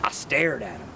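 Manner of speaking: plain reading voice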